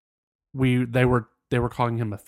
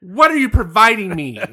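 demanding; 8 semitones higher, 6 dB louder than usual. Acted. Continuing the mock fight.

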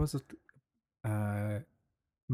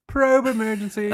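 laughing; 10 semitones higher, 3 dB louder than usual. Pretend. Laughing alien alarm.

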